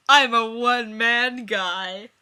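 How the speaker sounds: hammed declaration. Chandler declaring, overdone.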